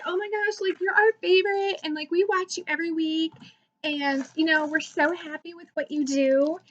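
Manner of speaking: high start easing down, imitated admiration; gushing fans. Fans praising their favorite YouTuber, imitated gushing. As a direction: mimic adoring fans, pitch starting high. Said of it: "other people are so nice".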